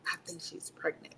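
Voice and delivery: whispered thought to herself; inner-thought whisper. Retold or imitated. Thinking about the sick captain, whispered to herself. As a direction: breathe it as a private thought. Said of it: "in my head".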